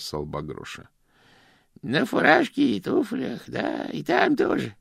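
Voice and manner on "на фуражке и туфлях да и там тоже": put-on old man echoes the phrase, then slows, hedging; reluctant old Grosh. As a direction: stall while answering the boy. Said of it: "ответил он". (Грош неохотно повторяет и медлит)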